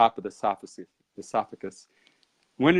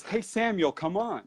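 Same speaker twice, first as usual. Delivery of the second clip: higher, softened coaxing call; beckoning in a lighter, higher voice; imitating urging companion; 6 semitones higher, 5 dB quieter than usual. Girl urging him onward.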